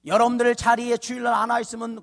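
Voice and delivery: high, emphatic pulpit questioning; forceful preaching. Real, not acted. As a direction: press the question on the listeners. Preaching to congregation.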